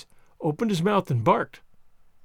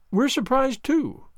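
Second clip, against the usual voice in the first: lightly, in a higher voice; a light, higher-pitched woman's reply; 8 semitones higher, slower than usual. A narrator's reading. Woman replying to an apologetic stranger, lightly. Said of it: "she answered lightly".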